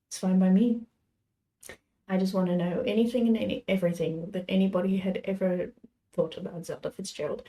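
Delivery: ordinary delivery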